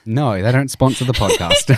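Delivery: loud laughing protest. Real, not acted. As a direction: laugh through the words.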